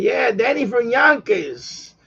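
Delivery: put-on slow, drawn-out New York accent on "Yonkers"; hammed-up New York accent introducing himself. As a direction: lay on the Yonkers New York accent, stretching each word. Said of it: "You know, we speak like".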